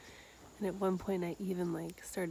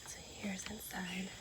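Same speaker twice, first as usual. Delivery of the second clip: very soft whisper; 9 dB quieter than usual. Real, not acted. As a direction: whisper it inside the hall. Quiet tour inside ceremony hall.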